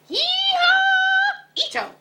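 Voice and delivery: very high, loud rodeo whoop; hollered whoop. A narrator's reading. Scapito riding the Bobolito, loud high whoop. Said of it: "hollered Scapito".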